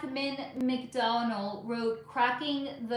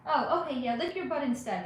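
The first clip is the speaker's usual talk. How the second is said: usual speaking voice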